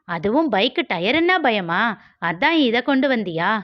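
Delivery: high, quick, mocking girl's voice; teasing girl Malar. Malar mocks Vicky on the street, high and teasing. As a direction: needle him like a cocky young woman.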